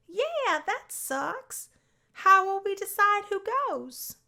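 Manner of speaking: high, bright-eyed worry; high voice, fretting over the one seat; excited girl. Acted. Sally fretting over the single van seat, high voice.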